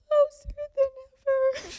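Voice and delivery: very high, loud, drawn-out choked squeal; tearful squeak. Real, not acted. Sister overcome in her toast to her sibling, tearful squeak. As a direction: squeeze the words out through rising tears. Tears up mid-toast, then repeats it.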